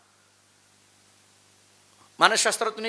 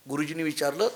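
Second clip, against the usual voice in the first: ordinary talk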